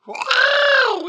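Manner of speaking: high shouted horse neigh; whinny loudly like a horse. Acted. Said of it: "Is that what a horse sounds like".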